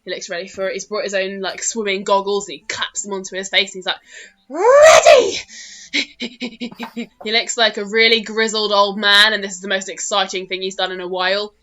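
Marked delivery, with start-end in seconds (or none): 4.5-5.4 high, loud shriek of readiness; excited gnome yell. Acted. Grizzled gnome strapped in for launch, shrieking high and loud. 5.4-7.2 gleeful gnome cackle. Acted. Old gnome laughing before launch, high giddy cackle.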